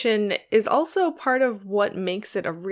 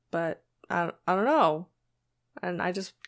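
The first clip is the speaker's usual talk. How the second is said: ordinary delivery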